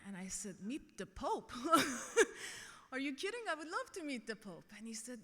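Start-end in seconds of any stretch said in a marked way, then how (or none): 0.6-4.7 excited disbelief. Retold or imitated. Retold delight at papal invitation.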